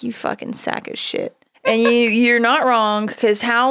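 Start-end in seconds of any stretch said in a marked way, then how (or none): 0.0-1.4 soft, unvoiced imagined grumble; muttered mock insult. Imagined Australian listeners cursing the host, muttered softly. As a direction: mutter it under your breath as an offended listener. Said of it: "people from Australia right now are like".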